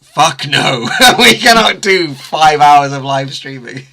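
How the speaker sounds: loud, slow, laughing no; mock firm refusal, laughing. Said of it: "they were like".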